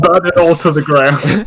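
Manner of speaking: gleeful villain shout. Acted. Joking destructive outburst.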